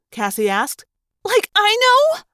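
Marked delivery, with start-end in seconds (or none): 1.2-2.2 very high, loud shrill retort; shrill it back in a panic. A narrator's reading. Panicking girl answers her friend, shrilled. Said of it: "I shrilled".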